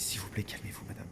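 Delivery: unvoiced, hushed plea to calm down; soft plea. Owner whispering to the furious lady to calm down, hushed. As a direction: barely breathe the words to her. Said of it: "Et j'ai dit".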